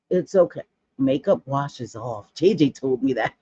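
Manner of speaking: ordinary delivery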